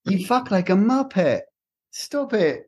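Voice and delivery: high, indignant British chef; Gordon Ramsay impression. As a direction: insult them like an exasperated celebrity chef. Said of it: "this is what he would be like".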